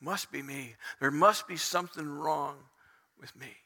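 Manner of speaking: low, soft, resigned lament; subdued, defeated admission; dejected self-blame. Acted. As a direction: sink quietly into blaming yourself. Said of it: "He says".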